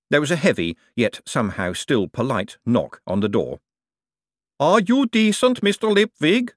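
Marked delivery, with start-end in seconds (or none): 4.6-6.5 high, slow golem call; big slow call, pitched way up; booming. A narrator's reading. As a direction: boom it through the door, slowly. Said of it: "a voice boomed".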